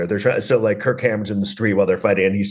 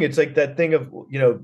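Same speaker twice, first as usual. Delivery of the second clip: ordinary delivery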